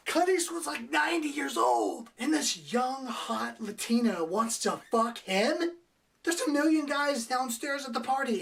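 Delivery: can you believe this, shout it out; disbelieving outburst. Real, not acted.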